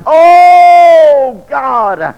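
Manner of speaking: a retold mother's desperate shouted plea; wailing cry. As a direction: cry out to God for a dying son. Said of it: "I heard somebody crying".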